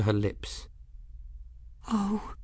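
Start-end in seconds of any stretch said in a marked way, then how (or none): 1.9-2.3 small, very high, soft gasp of a word; soft high little voice; whispered. A narrator's reading. Folly speaks to her crystal in a high, hushed voice. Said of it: "she whispered".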